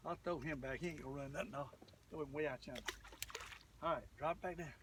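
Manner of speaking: usual speaking voice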